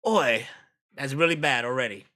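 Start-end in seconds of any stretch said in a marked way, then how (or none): 0.1-0.5 a hint of Cockney on Oi, barked loud; a quick Cockney Oi, punchy and loud. Streamer tries a Jason Statham Cockney: loud Cockney Oi. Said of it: "sound as much as Jason Statham".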